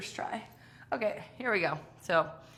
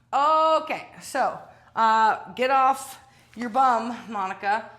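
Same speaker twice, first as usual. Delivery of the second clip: louder, higher rally to get up; self-rallying call; 4 semitones higher, 9 dB louder, slower than usual. Real, not acted. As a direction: push yourself up with a loud, high call. Self-prodding while exhausted.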